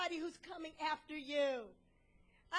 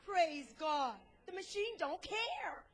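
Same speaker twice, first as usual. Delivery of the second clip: ordinary delivery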